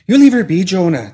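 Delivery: high, loud warning; sharp rise into a loud scolding; mock threat. A narrator's reading. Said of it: "said with mock threat".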